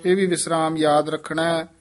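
normal talking voice

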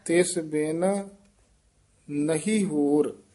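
ਤਿਸ ਬਿਨ, a small stop, then ਨਹੀਂ ਹੋਰ; short pause after ਤਿਸ ਬਿਨ. Shown as a demonstration. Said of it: "ਤਿਸ ਬਿਨ ਤੇ ਥੋੜ੍ਹਾ ਜਿਹਾ ਠਹਿਰਾ ਦੇ ਦੇਣਾ".

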